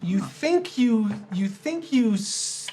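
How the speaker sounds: ordinary talk, nothing marked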